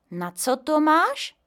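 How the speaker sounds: put-on high, slow, wondering little boy; the boy Honzík asking grandpa curiously; curious child. A narrator's reading. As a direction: ask it like a curious kid. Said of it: "zeptal se Honzík zvědavě".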